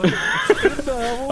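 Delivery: laughing. Real, not acted. Laughing at hole mixup.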